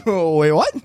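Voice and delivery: loud, shocked repeat of the double take; bigger mock double take. Repeated double take after laugh.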